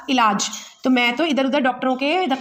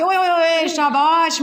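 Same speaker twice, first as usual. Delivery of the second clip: lion's hearty cry, only a little raised; mild mock shout; 3 semitones higher, much slower than usual. Lion praising his fox friend, mild mock shout. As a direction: lightly act out a lion calling out praise, drawn out. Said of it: "चिल्ला के बोला कि".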